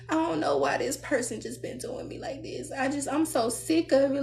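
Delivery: soft mock whine; mock whiny complainer. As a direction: whine it like someone fed up, half-mocking.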